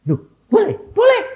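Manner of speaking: shocked, can't believe the answer; surprised disbelief. Retold or imitated. (terkejut tak percaya, makin tinggi)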